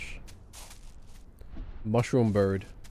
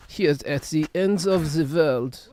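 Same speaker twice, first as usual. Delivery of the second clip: slow, higher, droning documentary voice; Werner Herzog impression; 4 semitones higher, slower than usual. Streamer narrating the end of the world as Werner Herzog. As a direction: intone it like a grim filmmaker. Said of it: "I'm Werner Herzog".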